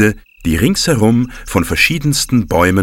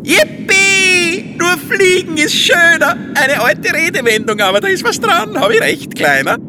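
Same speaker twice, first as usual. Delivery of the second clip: joyful whoop. Acted. Wurstel cheering while flying.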